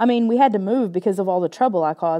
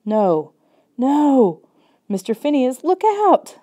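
horrified scream; 6 semitones higher, 5 dB louder, slower than usual. Acted. Car coming at the dog.

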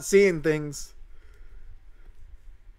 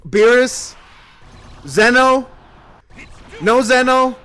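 hopeful calling out; 5 semitones higher, 7 dB louder, slower than usual. Real, not acted. Hoping for a summon pull.

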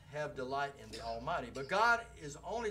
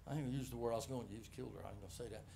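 muttered aside; 6 semitones lower, 8 dB quieter, much faster than usual. Real, not acted. Aside catching himself.

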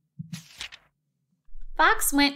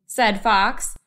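ordinary delivery, nothing marked

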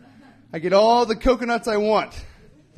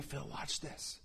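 imitating a whisper; 4 semitones lower, 21 dB quieter, slower than usual. Old man whispering to Phil.

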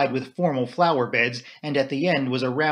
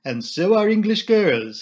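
put-on slow, higher French-accented admiration; Poirot confiding; 7 semitones higher, 5 dB louder than usual. A narrator's reading. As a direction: draw it out as an admiring Belgian detective. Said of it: "he said in a lower voice".

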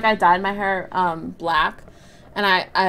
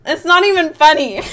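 high, loud protest through laughter; real laughter breaking into the words; laughing; 8 semitones higher, 8 dB louder than usual. Real, not acted. Streamer cracking up at own joke, laughing. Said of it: "I'm just laughing".

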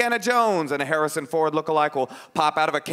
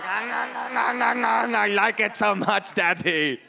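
put-on high, drawn-out gobbling kid voice; greedy child; 7 semitones higher, much slower than usual. Child to Daddy about eating money, gleeful mock shout. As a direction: shout it like a child gorging happily. Mimics child being trained.

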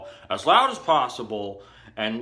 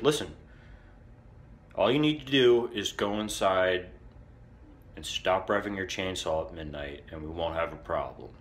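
lightly voiced cop, lower and softer toward the end; imitated stern cop; 5 semitones lower, 5 dB quieter than usual. Cop moving in close to give orders, mildly stern. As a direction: drop the volume as if an inch from his face. Cop leans in, lays down terms.

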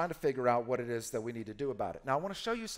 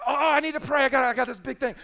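much higher, louder, hammed-up panic; mock urgency; 10 semitones higher, 10 dB louder than usual. Pastor imitating a believer eager to pray, high hammed-up panic. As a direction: squeal it like a frantic churchgoer. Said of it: "you're like, you're like".

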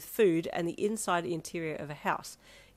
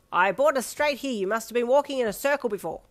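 put-on plain brash boy; 6 semitones higher, 8 dB louder than usual. A narrator's reading. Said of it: "he said plainly".

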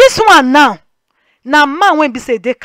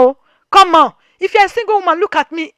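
normal talking voice

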